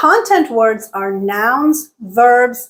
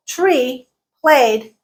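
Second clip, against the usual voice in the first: usual speaking voice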